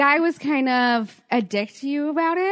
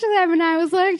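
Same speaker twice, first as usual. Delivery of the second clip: usual speaking voice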